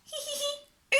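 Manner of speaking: high, squeaky little giggle; giggling. Acted. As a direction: giggle like a small child. Child laughing in story.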